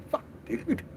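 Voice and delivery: breathy exasperated whisper of a curse. Real, not acted. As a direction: mutter it almost voiceless. Exasperated aside under breath.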